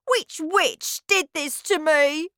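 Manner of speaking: high and slow, demanding; indignant demand. Acted. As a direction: demand to know who did it. Bruno demanding answers.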